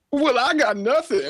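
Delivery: very high, slow put-on voice; Tutu. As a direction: answer in a silly cartoon falsetto. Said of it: "Well, Tutu, do you have anything".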